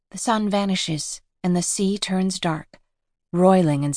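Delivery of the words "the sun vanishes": usual speaking voice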